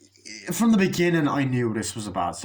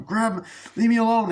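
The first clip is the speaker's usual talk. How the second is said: high, loud acted protest; mock frantic struggle; 9 semitones higher, 4 dB louder than usual. Corey struggling before he stabs the guy, mock frantic shouting. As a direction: shout it as if being grabbed.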